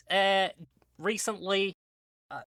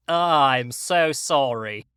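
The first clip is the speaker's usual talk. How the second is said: ordinary talk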